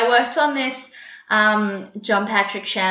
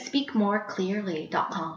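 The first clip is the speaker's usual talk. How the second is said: "Speak more clearly dot com" slowly and softly; slow, careful plug; 8 dB quieter, slower than usual. Real, not acted. Accent coach repeating her company's name, slow careful plug. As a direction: spell out each word unhurriedly, voice lowered.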